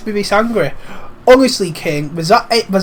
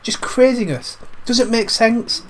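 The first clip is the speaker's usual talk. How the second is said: ordinary talk, nothing marked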